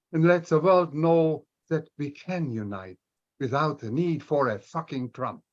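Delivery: normal talking voice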